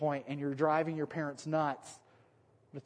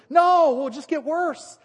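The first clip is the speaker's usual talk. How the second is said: higher, louder and slow mock defiance; defiant shout; 10 semitones higher, 11 dB louder, slower than usual. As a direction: shout back cheekily, drawn out. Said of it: "a bunch of Wisconsin people".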